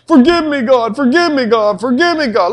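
high, begging repeated forgive me; mock pleading prayer. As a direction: beg over and over, hammed-up. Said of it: "Or this one".

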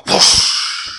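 wind whoosh. Acted. Imitating wind.

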